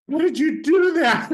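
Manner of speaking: words squeaked out very high through laughter; laughing quote. Retold or imitated. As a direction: laugh through his amazed question. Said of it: "he looked at me like".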